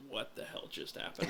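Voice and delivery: whisper. Retold or imitated. Quoting a baffled reaction.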